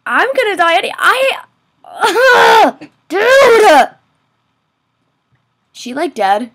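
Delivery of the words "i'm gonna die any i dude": high, loud wail of frustration; exasperated yelling. Real, not acted. Gamer losing it after a disaster, yelling high and loud. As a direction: break off into an exasperated yell.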